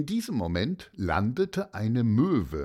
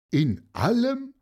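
high, louder incredulous question; disbelieving; 12 semitones higher, 5 dB louder than usual. A narrator's reading. Pupil questioning the siblings' claim, disbelieving. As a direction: query it in raised-pitch disbelief. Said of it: "fragte Martin Motzke ungläubig".

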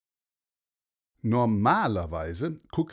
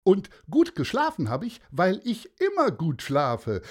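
hoch und laut ausgerufene Morgengrüße; fröhlicher Mann; 7 semitones higher, 4 dB louder than usual. Acted. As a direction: das Meer überschwänglich laut begrüßen. Mann erzählt weiter. (Mann im Schlafanzug grüßt das Meer, hoch und laut)

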